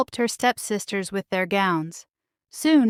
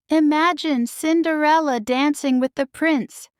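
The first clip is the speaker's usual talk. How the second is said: mocking; 7 semitones higher than usual. A narrator's reading. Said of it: "Drizella cackled".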